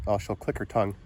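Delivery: usual speaking voice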